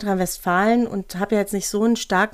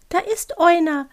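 startled small owl voice; agitated little owl; 9 semitones higher than usual. Acted. Owl noticing someone nearby, agitated little voice. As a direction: blurt it as a cute, flustered owl. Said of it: "rief die Stimme aufgeregt".

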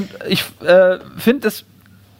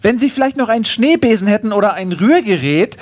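higher, courteous voice of praise and request; lightly put-on polite kangaroo; 4 semitones higher than usual. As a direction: ask sweetly for another loan, pitched up. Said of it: "sagt das Känguru".

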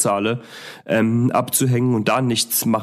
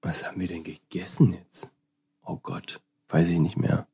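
whispering to self; 6 semitones lower, 6 dB quieter than usual. Real, not acted. Trying to remember, musing to self.

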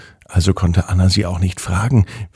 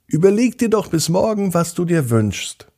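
high, quick pony voice; bright little pony, high and quick; talking pony; 10 semitones higher than usual. Acted. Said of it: "sagte das Pony Wünsch dir was".

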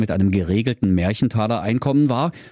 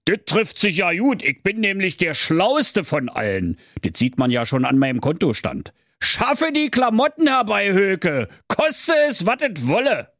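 Kaiser calling out; 9 semitones higher than usual. Acted. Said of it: "rief der Herr Kaiser".

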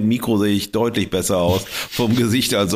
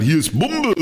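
higher, imitating the official's intonation; mock official tone; 9 semitones higher than usual. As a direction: say it just like the guard did, pitched up. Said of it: "aber genau so intoniert auch". (nachgeahmter Tonfall des Polizisten, höher)

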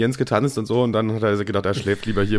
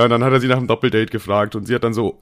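ordinary talk, nothing marked

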